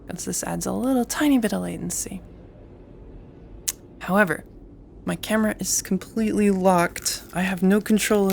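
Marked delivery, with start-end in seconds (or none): none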